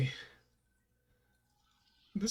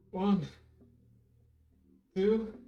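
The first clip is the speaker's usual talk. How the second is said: counting each squat, pitched up; 6 semitones higher, much slower than usual. Real, not acted. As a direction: count the reps while exercising. Counting reps while doing squats.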